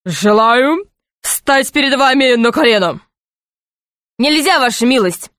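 mildly solemn knight; a touch of pomp; solemn declaration. A narrator's reading. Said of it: "торжественно заявил".

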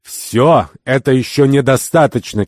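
high, loud indignation; fuming. A narrator's reading. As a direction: fume at the excuse. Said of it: "кипятился Сафар".

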